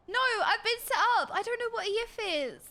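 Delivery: high-pitched plea of innocence; dismayed protest. Real, not acted. Streamer protesting to chat about being set up, dismayed.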